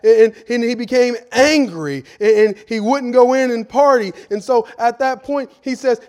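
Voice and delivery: loud, impassioned sermon delivery; fiery preaching. Acted. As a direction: preach it out full force to the congregation. Preaching at full force.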